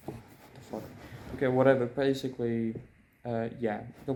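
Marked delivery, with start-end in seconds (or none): 0.1-0.8 very soft, voiceless aside; barely audible muttered curse; muttered exasperation. Real, not acted. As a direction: mutter it under your breath at a misbehaving computer. Computer wants to update mid-video.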